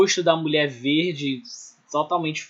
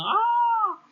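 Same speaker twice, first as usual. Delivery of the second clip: high-pitched drawn-out "ah"; imitated scream. Teacher mimics the screaming figure in O Grito, mock scream.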